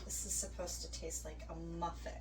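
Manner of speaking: hushed whisper over food. Real, not acted. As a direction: breathe it out, barely voiced.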